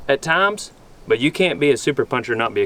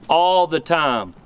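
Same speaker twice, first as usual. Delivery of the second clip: normal talking voice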